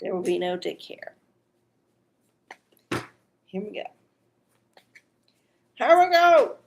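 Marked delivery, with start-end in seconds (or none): 3.5-3.8 quiet murmur. Real, not acted. Host getting ready to draw, quiet murmur. 5.8-6.6 high, loud kickoff shout; hyped-up call. Real, not acted. Host starting the oracle card draw, hyped-up call.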